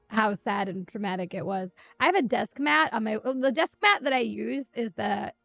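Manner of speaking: usual speaking voice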